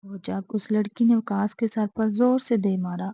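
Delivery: usual speaking voice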